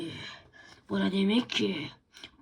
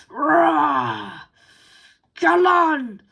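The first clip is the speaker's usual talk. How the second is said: loud, much higher snarling yell; loud and higher, charging in; battle yell; 7 semitones higher, 12 dB louder, much slower than usual. Acted. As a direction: roar him into the fight. Dog attacking in fight.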